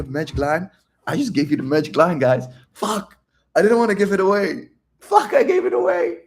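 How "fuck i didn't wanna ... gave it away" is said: high, loud fake panic; mock dismay. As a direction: pretend he let the secret slip. Fake dismay at revealing secret.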